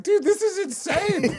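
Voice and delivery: high, drawn-out exasperation; retold disbelief. Reacting to attacker's insult, high slow disbelief. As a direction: stretch it out in comic incredulity.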